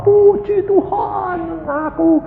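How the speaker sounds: high, slow, drawn-out praise; put-on delirious singsong voice; imitated giddy praise. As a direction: mimic someone between weeping and laughing. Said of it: "lalu bil-, ketawa-ketawa".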